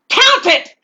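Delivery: high, loud bark; shouted order. Acted. Robber yelling at the clerk to count, high and loud. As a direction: snap the order at her.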